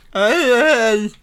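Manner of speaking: taunting impression of haters; mocking haters. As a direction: heckle like a hater. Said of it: "That's an impression of, uh, you know, my haters".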